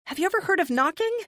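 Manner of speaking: high, fast, loud yelling; indignant yell. A narrator's reading. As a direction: fire it back, shrill and quick. Shouting at intruding friend.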